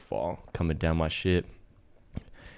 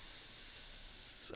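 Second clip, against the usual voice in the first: unvoiced air-leak hiss; hissing tire sound; 18 dB quieter than usual. Tire deflating noise.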